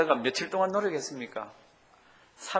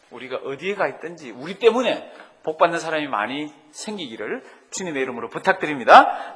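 ordinary delivery

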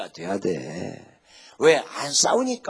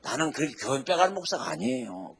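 normal talking voice

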